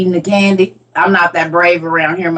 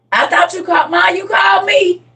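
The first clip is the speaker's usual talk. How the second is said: put-on insistent kids; 11 semitones higher than usual. Kids telling mom she called them. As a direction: insist like an eager child.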